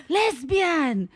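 put-on high, outraged Persian-accented mother; hammed-up, high-pitched Persian accusation; attacking Persian mom. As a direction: pounce on the word like a mother on the attack. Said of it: "about to attack me".

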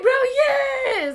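high, loud yell of confirmation; excited shout. Retold or imitated. Best friend yelling that it's the same guy. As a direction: belt it out in shocked agreement. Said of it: "she was like".

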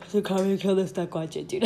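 talking through a yawn. Real, not acted. Tired storyteller yawning mid-story, voice caught in the yawn. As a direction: let a sleepy yawn stretch the words. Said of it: "I'm yawning".

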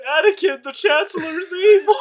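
high, loud, laughing mock plea; mock Obi-Wan. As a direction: ham up a desperate Jedi warning through laughter. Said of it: "when Obi-Wan's like".

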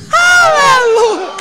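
long, very high shout of praise; shouted aleluia. Real, not acted. Preacher calling out praise to the congregation.

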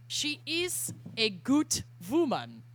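slow, hammed-up Polish accent. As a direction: read it in a put-on Polish accent. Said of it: "written in a frenetic Polish accent".

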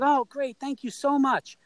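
put-on high-pitched grateful kid; excited thanks. Kid thanking star player for tickets and passes, acted excitement. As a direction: gush lightly, re-enacting a thrilled young fan.

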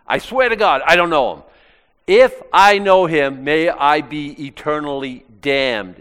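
loud, high, emphatic vow; swearing an oath. Acted. Peter putting himself under oath to deny Jesus, emphatic swearing. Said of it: "He's swearing".

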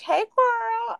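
high, sweet mock "Hey, girl"; sugary fake greeting. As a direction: chirp it up like a fake friend.

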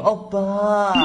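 lightly voiced sweet girl calling oppa; mock-cute coo at her boyfriend; sweet Korean girl. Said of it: "一个韩国女孩儿非常甜美的 对你说".

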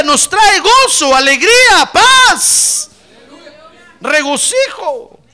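loud, high, slow proclamation with drawn-out words; full-force preaching. Real, not acted. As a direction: ring out each blessing to the congregation. (predicación a viva voz, alargando las palabras)

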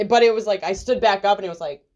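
ordinary talk

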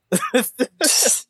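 short burst of high laughing; high laughter. Real, not acted. Reader laughing at the hangover line, high laughter. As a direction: crack up briefly.

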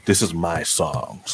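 karaoke singer. Retold or imitated. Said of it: "It's like".

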